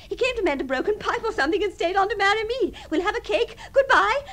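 high, gushing happy news; elated telephone chatter; excited announcement. Acted. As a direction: rattle off good news, lifting to a high goodbye. Phoning aunt with engagement news.

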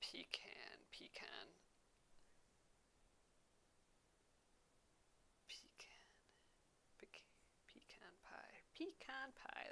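"pecan" as PEE-kahn, very soft; own pronunciation. Shown as a demonstration. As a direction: whisper "pecan" to test it, long ee first. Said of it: "that's how I say it".

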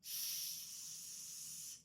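long voiceless "с" hiss, very soft; sustained quiet hiss of "с"; air only. Shown as a demonstration. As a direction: let only air through on a drawn-out "с". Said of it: "пропустити спочатку просто повітря".

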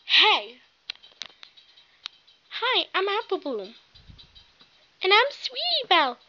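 ordinary talk

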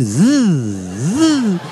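wavering motor-like zzz; imitated buzzing. Son frozen in the hall imitating the buzz, swooping drone. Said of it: "escutei um zzz".

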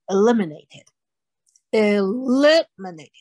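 eliminated said slowly with the weight on "li": e-LI-mi-na-ted; stress on second syllable. Shown as a demonstration. Teacher drilling the class on "eliminated", slow, second syllable stressed. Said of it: "重音在第二音节".

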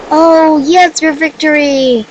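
loud, high victory cheer; gleeful gamer cheer; cheering win. Real, not acted. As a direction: shout it out, you just won. Winning a match, cheering.

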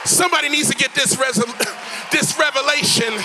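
high, driving sermon building to a shout; full-force preaching. Real, not acted. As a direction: rouse the congregation, climbing to a huge last word.

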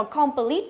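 "complete" with a full KOM and short rising plit; wrong-way demo of "complete", Vietnamese-style syllables; common mispronunciation. Retold or imitated. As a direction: imitate learners saying "complete" as com-pơ-lít. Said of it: "rất nhiều bạn hay đọc là".

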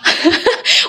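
stifled giggles. Real, not acted. Giggling during no-laugh challenge.

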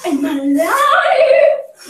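slow laughing and crying. Real, not acted. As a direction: laugh through the shock. Laughing after cold dunk.

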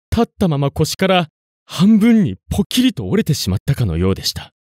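ordinary delivery, nothing marked

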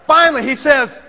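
ordinary delivery, nothing marked

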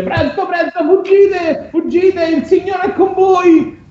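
high, hammed-up cry urging people to flee; urgent shouted warning. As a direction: shout them on in a high, carrying voice.

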